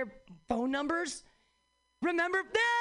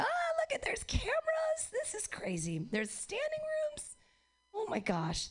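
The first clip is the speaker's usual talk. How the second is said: high, breathy gasping wonder; mock awe; 13 semitones higher, 3 dB quieter than usual. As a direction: gush like a starstruck newcomer. Mock awed at the venue.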